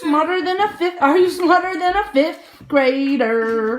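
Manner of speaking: slow loud announcer voice; game show host. Retold or imitated. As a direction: boom it like a TV host. Quoting a game show title.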